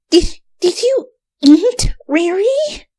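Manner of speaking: high, halting, near tears; sputtering shock. A narrator's reading. Twilight sputters at Rainbow, horrified and upset. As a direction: stammer in horror. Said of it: "Twilight sputtered".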